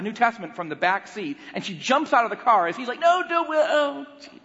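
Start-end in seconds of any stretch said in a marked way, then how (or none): 3.0-4.4 high, strained wail of objection; mock panicked protest. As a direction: imitate a husband helplessly calling after his wife. Husband protests as wife leaves.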